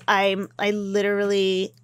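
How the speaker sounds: ordinary talk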